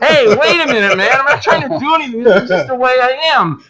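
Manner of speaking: wide-eyed defensive protest; mock protest. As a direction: play innocent and taken aback. Retold protest at hugging complaint.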